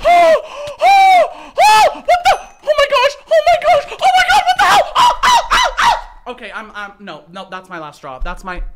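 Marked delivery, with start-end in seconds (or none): 0.1-6.3 shrill, high, loud shrieks of shock; shocked screaming. Real, not acted. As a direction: scream in total disbelief. Sudden shock at an ambush.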